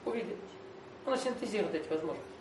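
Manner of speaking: usual speaking voice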